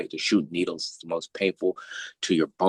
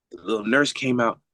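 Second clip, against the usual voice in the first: normal talking voice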